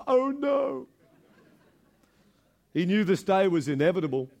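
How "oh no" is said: high, strange comic calf voice; fattened calf realizing its fate, high put-on voice; dismayed calf. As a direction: squeak it out as a doomed calf. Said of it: "the fattened calf goes".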